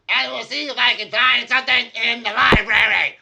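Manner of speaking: very high, loud squeaky voice; Voz the gnome. Acted. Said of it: "and Voz will say".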